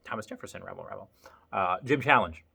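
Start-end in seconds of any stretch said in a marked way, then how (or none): 0.0-1.1 much softer mock mumbling; quiet mumble. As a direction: drop to a soft mumble. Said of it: "Not just to hear me rabble".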